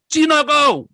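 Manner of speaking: high, loud jeering shout; shouted slur, re-enacted at full volume; imitated mob taunt. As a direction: yell it like an attacking village mob. Retelling villagers' taunt while beating him.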